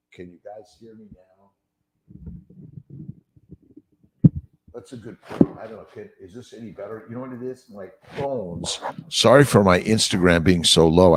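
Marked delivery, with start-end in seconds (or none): 0.1-8.8 faint, distant voice picked up by a phone; off-mic phone audio. Real, not acted. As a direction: speak away from the microphone, thin and far off. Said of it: "I'm talking into my, into my, uh, microphone".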